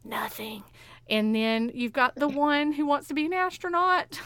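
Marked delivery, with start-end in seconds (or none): 0.0-0.6 a hushed, conspiratorial nothing; whispered spoiler. Pretend. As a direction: whisper the punchline.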